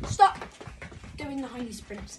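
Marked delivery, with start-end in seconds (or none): 0.1-0.4 sharp loud call; shouted stop. Real, not acted. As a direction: yell it across the room. Calling stop to runners.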